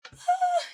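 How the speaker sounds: a heavy, audible sigh; loud sigh. Real, not acted. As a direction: let out a big sigh before speaking. Tarot reader sighs before a point.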